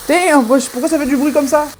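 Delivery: loud startled exclamation; surprised. Real, not acted. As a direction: react loud to the noise. Startled by sizzling pan.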